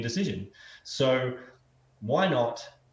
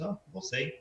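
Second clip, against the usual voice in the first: calm farmer; 4 semitones higher, 5 dB quieter than usual. Acted. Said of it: "the farmer again just goes".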